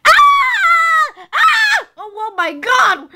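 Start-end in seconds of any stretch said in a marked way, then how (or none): 0.1-1.9 high-pitched acted screams; panicked scream. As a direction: scream out in alarm.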